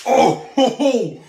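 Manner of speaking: high loud mock cheer; loud relieved ho ho. Real, not acted. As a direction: cheer like a game show winner. Relief at right answer.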